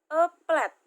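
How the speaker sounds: teacher reading A plate loud for class to copy; model reading. Shown as a demonstration. Teacher modelling A plate to students, loud and clear. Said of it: "Di sini ada contoh, [name] kasih".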